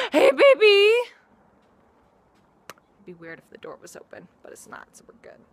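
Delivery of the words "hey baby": put-on a screaming, very high, very loud hey baby; shrieked greeting. Greeting a girl across the room with a loud shriek. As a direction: shriek it out at full blast.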